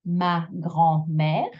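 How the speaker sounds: slow syllable by syllable, MÈRE stretched and rising; ma, grand, then a long lifted MÈRE; MÈRE elongated. Shown as a demonstration. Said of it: "it's on the mère that I elongate".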